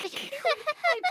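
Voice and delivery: high burst of giggling; high giggle. Real, not acted. Laugh alone.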